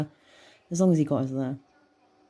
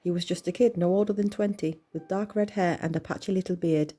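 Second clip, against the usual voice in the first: ordinary delivery, nothing marked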